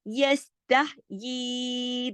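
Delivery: measured Quran-lesson recitation of यस्तहयी; drawn-out vowel. Shown as a demonstration. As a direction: hold the end of यस्तहयी on a long, steady note. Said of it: "दो हरकत खींचा".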